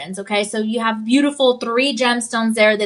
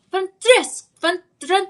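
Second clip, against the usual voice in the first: Francesca in a higher, Italian accent, with a rolled, singing vowel on the end; 7 semitones higher, slower than usual. Shown as a demonstration. Host answers a viewer's request, Italian accent. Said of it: "Say Francesca with the accent".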